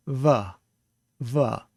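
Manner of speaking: "va" com lábio inferior nos dentes; fricative v. Shown as a demonstration. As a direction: deslize o v em "va". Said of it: "bottom lip up against your teeth".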